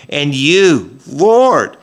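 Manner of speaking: a king's anguished plea, pitched high; impassioned cry. Retold or imitated. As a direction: cry it out like a desperate king. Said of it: "King David cries out".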